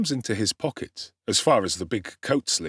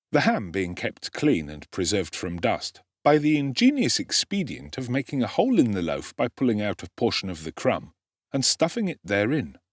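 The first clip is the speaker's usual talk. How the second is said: plain reading voice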